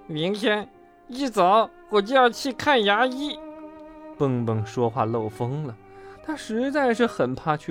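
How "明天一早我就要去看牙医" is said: high voice, air leaking through broken tooth; gap-toothed, slushy consonants; lisping through gap. A narrator's reading. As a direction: lisp it as if missing a tooth.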